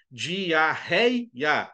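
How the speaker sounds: DIARREIA slowly split, leaning on REI; syllable-by-syllable example. Shown as a demonstration. Teacher showing a diphthong example, "Diarreia" split into syllables. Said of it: "Aqui estão alguns exemplos".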